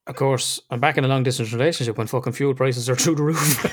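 normal talking voice

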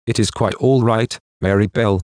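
usual speaking voice

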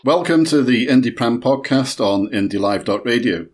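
normal talking voice

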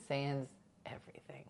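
a secret whispered aside; hushed whisper. Real, not acted. A cheeky confession to viewers, in a whisper. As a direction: breathe it, no voice at all. A joke said under the breath.

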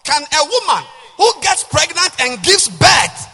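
loud, high, slowed sermon cry; preaching. Acted. Pastor preaching loud to the church. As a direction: preach it with full force. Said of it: "I'm preaching".

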